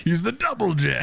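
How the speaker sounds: dramatic announcer. Pretend. Echoing the hero's name, mock trailer voice. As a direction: echo the hero's name grandly.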